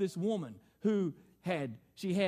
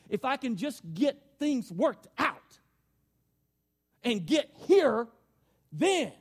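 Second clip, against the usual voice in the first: louder, higher, driving sermon voice; full-force preaching; 6 semitones higher, 7 dB louder than usual. Real, not acted. Pastor pressing his congregation, loud preaching. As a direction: preach it to the back row.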